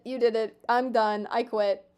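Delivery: ordinary talk, nothing marked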